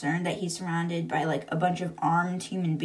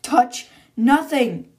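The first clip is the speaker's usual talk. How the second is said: slow, louder shouted command; yelled order; 5 semitones higher, 9 dB louder, much slower than usual. Retold or imitated. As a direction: bark it back slowly, each word weighted. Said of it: "Ophelia yells back".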